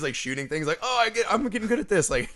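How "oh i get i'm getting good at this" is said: high, quick, thrilled delivery; Boyega. Retold or imitated. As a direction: imitate giddy surprise at one's own skill. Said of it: "Boyega's like".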